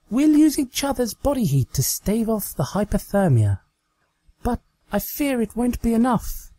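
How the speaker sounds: milk the pretend danger; hammed-up melodrama. Pretend cabin scene continues.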